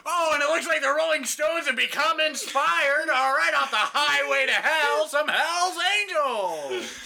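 excited announcer. Acted. Mock announcer.

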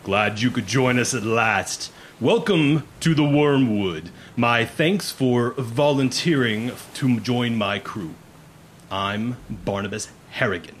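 measured, commanding voice that swells; booming captain. Acted. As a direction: project like a commander addressing new crew. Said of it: "you hear a booming voice say".

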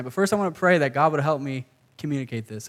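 ordinary talk, nothing marked

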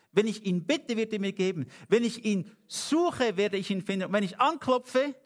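fervent preaching. Acted. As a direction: preach it out, rising with conviction.

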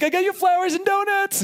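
very high, giddy mock squeal; hammed-up high-pitched excitement; excited announcement. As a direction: squeal it back, presenting a surprise. Husband excitedly presenting surprise gifts.

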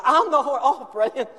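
high, loud eager order; a king ordering the robe; excited command. Acted. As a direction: snap it out with glee.

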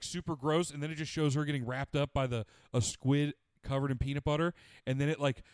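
ordinary talk

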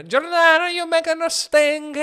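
high, loud wail; mock howling. Comic calling out to Nana, mock howling. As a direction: howl it out high, putting on a voice. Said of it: "Just howling".

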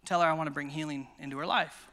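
low, lightly voiced divine prompting; God the Father. The Father telling preacher about her lost daughter, lightly voiced. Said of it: "I feel like the Father say".

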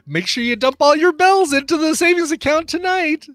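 high, loud mock character voice; squeaky know-it-all telling you to save; mock bossy reminder. Friend voicing the game character's advice, high and loud. Mocking what the character should say.

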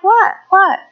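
high-pitched clueless "what?"; puzzled, high mock questioning; mock oblivious. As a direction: play the parent who notices nothing. Oblivious parent acted in story.

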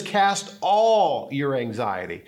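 slow, with "all" leaned on hard; stress on ALL. Shown as a demonstration. Preacher to his flock, pressing "all". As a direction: land on the word "all" as the point. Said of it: "Not just the anxiety".

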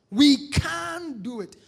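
slow, loud and high; can-do declaration. Acted. Preacher declaring to the congregation, slow loud can-do cry. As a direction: hammer each word home.

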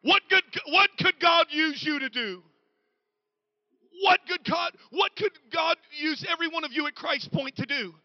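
loud, high preacher's build to a shout; preaching at full force. Acted. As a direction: drive it home from the pulpit. Preacher's challenge.

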